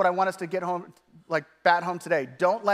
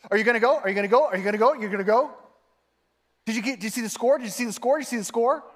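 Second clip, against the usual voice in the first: insistent questions, each rising at the end; nagging; 4 semitones higher, much faster than usual. Retold or imitated. Someone badgering over and over, nagging. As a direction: pester with the same question repeatedly. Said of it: "say something over and over".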